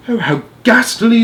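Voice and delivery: very high, stricken royal cry; a queen crying out in horror; horrified cry. Acted. Queen reacts to the news, high horrified cry. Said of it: "the Queen cried out".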